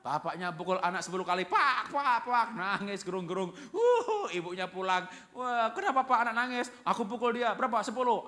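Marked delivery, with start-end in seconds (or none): 1.5-2.5 loud, high "pak" smacking sounds; imitated smacks. Father hitting his child ten times, loud imitated smacks. 3.7-4.3 high, loud imitated bawling; mock wail. Child crying after father's beating, mock wail. 5.3-8.3 loud, high-pitched mock alarm; acted parental uproar. Mother comes home to crying child, loud acted alarm.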